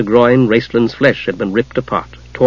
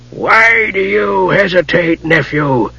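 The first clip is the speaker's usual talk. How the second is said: dry rasping voice; 6 semitones higher, slower than usual. Acted. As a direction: demand it in a dry, irritable rasp. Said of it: "a dry, rasping voice demanded irritably".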